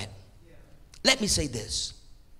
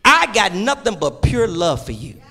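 ordinary delivery

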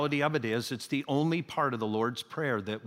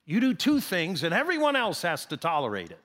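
usual speaking voice

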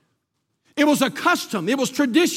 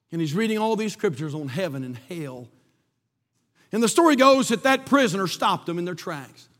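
normal talking voice

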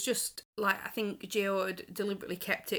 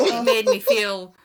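mock outrage; 13 dB louder, slower than usual. Real, not acted. Comic rant about repulsion.

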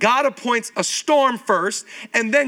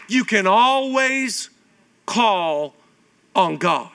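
slow emphatic point; much slower than usual. Shown as a demonstration. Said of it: "write down number two".

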